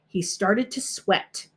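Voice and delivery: ordinary delivery, nothing marked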